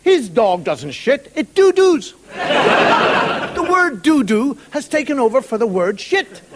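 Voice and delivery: ordinary talk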